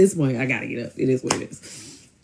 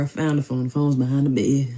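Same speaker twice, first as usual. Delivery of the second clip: ordinary delivery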